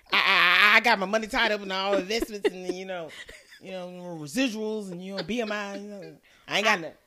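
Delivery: loud bragging producer impression; boastful producer voice. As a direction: brag loudly like the producer.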